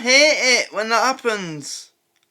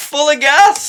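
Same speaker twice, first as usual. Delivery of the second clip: a real laugh, higher and louder; laughing shout; 6 semitones higher, 7 dB louder than usual. Real, not acted. Viewer cracking up at a clip joke, laughing shout. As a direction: howl the question through laughter.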